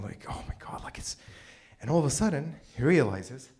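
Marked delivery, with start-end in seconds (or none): 0.0-1.2 breathless whispered disbelief; awed whisper. Retold or imitated. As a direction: whisper it, stunned by the miracle. Peter awed walking on water.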